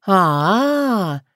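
long, thoughtful "ah"; profoundly drawn-out. A narrator's reading. Yaga taking in the cat's answer, drawn-out and pensive. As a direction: draw it out as if deeply enlightened. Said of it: "глубокомысленно протянула яга".

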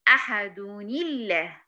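أحد الله as very slow "ahaduni-llah"; correct joined reading. Shown as a demonstration. Teacher showing how to join verses, correct joined reading. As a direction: link أحد to الله with a kasra, "-ni". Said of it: "لما أجي أوصل أقول إيه".

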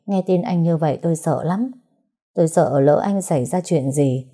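plain reading voice

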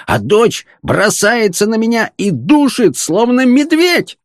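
high-pitched tirade of grievances, climbing to the end; indignant shouting. A narrator's reading. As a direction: list every outrage in exasperation. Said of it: "кричал фон Хартман, топая ногами".

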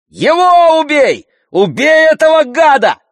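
very high, furious yell; shouting. A narrator's reading. Brother demands the villain be killed, shouting. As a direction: yell it fiercely, drawing it out. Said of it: "крикнул Андрей".

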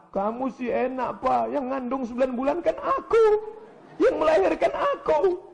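very high mock wail; tearful wife's retort. Wife tells husband she carried the child, mock-wailing protest.

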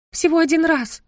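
squeak. A narrator's reading. Embarrassed daughter squeaks a confession to her parents, high. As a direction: squeak it out guiltily. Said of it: "пискнула я".

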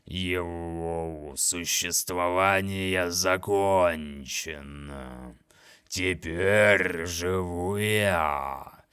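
slow, grinding monster voice; grating voice. Acted. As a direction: gloat slowly, every word heavy. Monster speaks.

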